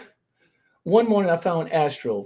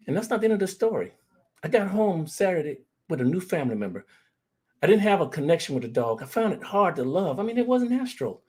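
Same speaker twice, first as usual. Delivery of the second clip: usual speaking voice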